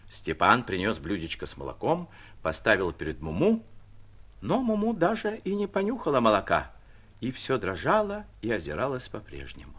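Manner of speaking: plain reading voice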